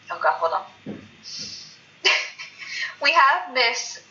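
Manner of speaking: ordinary talk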